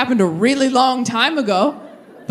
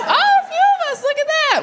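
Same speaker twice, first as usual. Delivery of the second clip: much higher, surprised exclamation. Real, not acted. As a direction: react to raised hands, amazed. Crowd work surprise.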